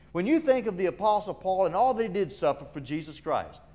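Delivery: ordinary delivery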